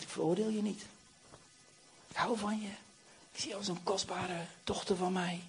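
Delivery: very soft, gentle forgiveness; hushed, loving comfort; tender reassurance. Acted. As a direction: speak softly, as if to a beloved child. Jesus tenderly reassures the accused woman.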